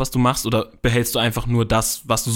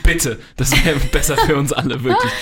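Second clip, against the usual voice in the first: laughing; 3 semitones higher, 4 dB louder than usual. Real, not acted. Teasing amid laughter.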